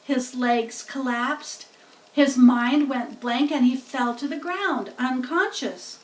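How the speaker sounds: usual speaking voice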